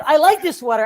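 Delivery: high, loud offended imitation; mock defensive protest. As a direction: defend yourself, hurt and hammed up. Comedian defends his sweater.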